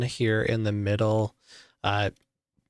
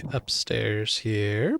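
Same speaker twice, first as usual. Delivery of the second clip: ordinary talk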